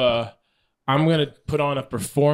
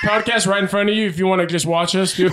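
mock doorstep pitch; 6 semitones higher, 4 dB louder, faster than usual. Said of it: "Bring the doorbell".

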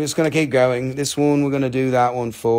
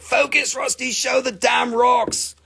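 much higher, half-shouted slow demand to focus; mock yelling viewers; 9 semitones higher than usual. Impatient viewers telling him to show the rocks, mock shouting. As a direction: bellow like impatient buyers. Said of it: "They're all just like".

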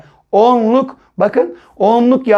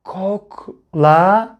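Koklama very slowly as kok, la, ma; syllable by syllable; much slower than usual. Shown as a demonstration. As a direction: break Koklama into separate syllables with pauses. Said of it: "Son kelimemize bakıyoruz".

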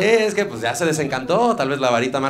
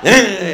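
louder, higher mock yelp of a sudden brake; mock braking cry; 8 semitones higher, 11 dB louder than usual. Driver slamming the brakes on the popemobile, mock yelp. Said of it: "así, frenón".

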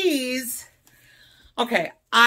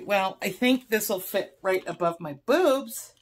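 normal talking voice

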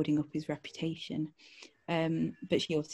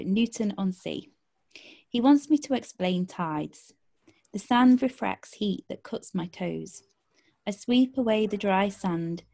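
poetry reading; 2 semitones higher, 4 dB louder than usual. Acted. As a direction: read the poem with weight and care. Poem read aloud.

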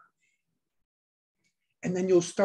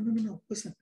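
normal talking voice

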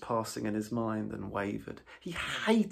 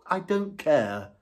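slow, louder, stubborn refusal; dogged rat; 6 semitones higher, 7 dB louder, slower than usual. A narrator's reading. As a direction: plant your feet and refuse. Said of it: "said the rat doggedly".

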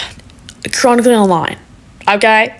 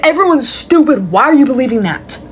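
ordinary talk

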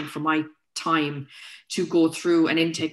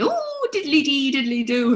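high, drawn-out sing-song mock Irish; mock Irish accent; 7 semitones higher, 3 dB louder than usual. People in Australia teasing the Irish speaker, mock Irish accent. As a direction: tease with a caricatured Irish lilt. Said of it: "people used to be saying to me".

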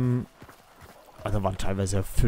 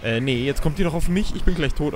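louder, higher panicked complaint; 6 semitones higher, 5 dB louder than usual. Real, not acted. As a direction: panic as the enemy closes in. Enemy attacking, panicking.

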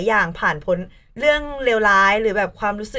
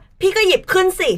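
imitated higher-pitched snap; mock snappy retort; 8 semitones higher, 4 dB louder than usual. Imitating bandmate over a trodden skirt, huffy. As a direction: act out a bandmate's huffy comeback. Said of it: "ก็อินเหวี่ยงใส่พี่ก่อน".

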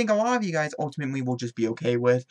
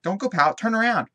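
bossy loud order; 6 semitones higher, 7 dB louder than usual. Pretend. Ordering the pouting Sim.

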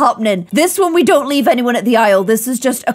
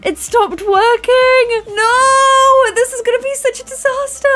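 high, slow, hammed-up wail; dramatic despair; 12 semitones higher, slower than usual. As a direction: stretch "It stopped working" out high and slow in mock despair. Clone machine breaks, dramatic despair.

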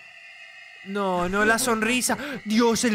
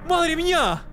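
real fright; 12 semitones higher, much slower than usual. Real, not acted. Attacked in game, screaming.

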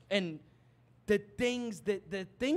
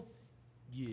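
very soft, voiceless little yeah; low-effort murmured reply; imitated kid's offhand reply; 6 semitones lower, 12 dB quieter than usual. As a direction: mimic a kid barely bothering to answer. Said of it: "stop talking to me like that".

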